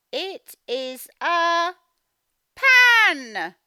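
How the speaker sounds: slow, loud, high It is a pan; dramatic reveal. Shown as a demonstration. Teacher revealing the pan to the children, loud and drawn out. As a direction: build up to pan and burst out. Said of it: "reading slowly and grandly".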